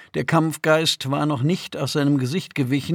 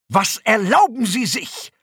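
high, loud outrage; indignant protest; 8 semitones higher, 5 dB louder than usual. A narrator's reading. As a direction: protest like an affronted noble prisoner. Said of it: "protestierte der Neuankömmling". (empörter, lauter Protest)

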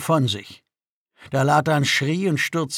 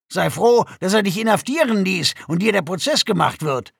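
loud, raised-pitch belittling; menacing contempt; 5 semitones higher, 5 dB louder than usual. A narrator's reading. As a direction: belittle the captive with forceful scorn. Guard threatens and belittles prisoner. (drohend und verächtlich, laut)

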